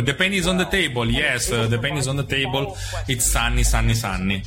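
English with a heavy Italian accent. Shown as a demonstration. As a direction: speak English the Italian way, broken. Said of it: "Il mio inglese è un po' maccaronico".

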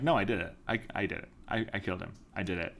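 low, soft mock admission; imitating resigned confession. Accused woman insists to Sam, resigned. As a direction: drop it low and repeat it quietly. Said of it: "she's, like, fully being like".

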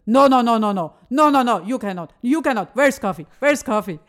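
loud, insistent imitation of the cleaner; loud mock protest. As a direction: hammer out the no's like an upset cleaner. Putzfrau zitiert, aufgebracht. (laut nachgeäffter Protest der Putzfrau)

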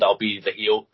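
ordinary talk

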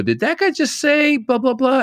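very high-pitched mock astonished question; onstage mock incredulity. Comic going up after another act, mock astonished. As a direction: squeal it up high, as if stunned by the last act.

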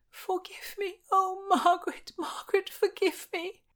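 very high, plaintive begging; desperate pleading. A narrator's reading. Anne begs forgiveness after Margaret shoves her, pleading. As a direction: beg in a thin, high plea.

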